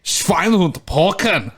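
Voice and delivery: put-on German accent. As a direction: copy the throaty German growl. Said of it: "Yeah, there you go".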